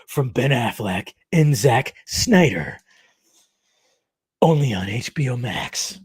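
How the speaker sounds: slow, dramatic trailer announcer; movie trailer voice. Shown as a demonstration. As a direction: announce it like a film trailer, drawn out. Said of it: "It's my movie voice".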